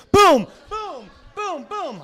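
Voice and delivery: high booms, loud then easing off; mock booms. Host ribbing Uncle Chow, mock high booms. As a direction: punch out high cartoon booms.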